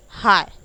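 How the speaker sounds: हाय said loud; silent GH demo. Shown as a demonstration. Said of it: "देखिए हाय बोलते हो आप". (हाय, GH बिना)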